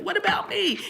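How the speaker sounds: high, whiny plea; mock needy whine. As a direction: whine for attention. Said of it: "We all did that".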